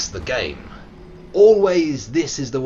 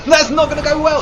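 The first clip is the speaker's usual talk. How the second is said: high, loud exclamation; loud in-game dismay; alarmed yelling; 14 semitones higher, 10 dB louder, faster than usual. Real, not acted. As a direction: cry out in alarm.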